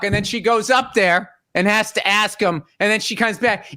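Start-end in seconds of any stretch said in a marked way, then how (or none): none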